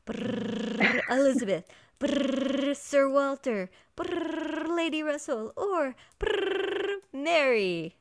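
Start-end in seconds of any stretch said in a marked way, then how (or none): 0.0-1.0 rolled-lip brrr drum roll. Pretend. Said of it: "here are my drum rolls". 2.0-2.8 rolled-lip brrr drum roll. Pretend. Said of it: "here are my drum rolls". 4.0-4.8 rolled-lip brrr drum roll. Pretend. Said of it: "here are my drum rolls". 6.2-7.0 higher rolled-lip brrr drum roll. Pretend. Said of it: "here are my drum rolls".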